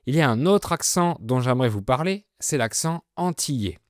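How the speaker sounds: normal talking voice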